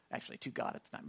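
whispered, quick correction; whispered aside. Real, not acted. Pastor corrects himself mid-story, in a whisper. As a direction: slip the correction in under your breath.

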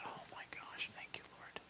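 breathy unvoiced thanks, almost silent; whispered prayer. Patient thanking God on the gurney, whispering. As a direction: murmur the thanks under your breath in a whisper. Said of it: "I was just whispering it".

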